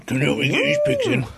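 high, fast West Country farmer; squeaky rushed yokel call. Acted. Farmer voice joke.

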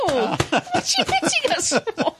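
high squeaky penguin voice. Pretend. Being hit with newspaper.

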